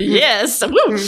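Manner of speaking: high, loud little whoop; playful cheer; excited cheer. Real, not acted. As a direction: let out a happy whoop. Cheering the permission to swear.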